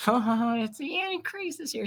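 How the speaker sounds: high mock gasp of delight, lightly voiced; mock excited fan. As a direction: gush like a starstruck believer. Mocking excited crowd.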